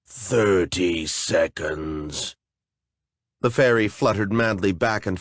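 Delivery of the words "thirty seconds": lower, very slow monster voice; wet monster voice. Acted. A flesh golem setting a riddle for intruders, slow and lower. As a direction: drag each word out like a lumbering golem.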